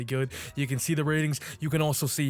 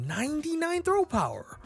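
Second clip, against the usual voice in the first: much higher, shocked exclamation; surprised; 12 semitones higher than usual. Real, not acted. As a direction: gasp it out in disbelief. Stunned at a rival's rating.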